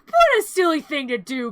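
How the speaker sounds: loud mock scolding, starting high; playful chiding. Boy chides pet fallen in trash can, mock scolding. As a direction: chide a pet in pretend dismay.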